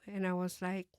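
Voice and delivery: usual speaking voice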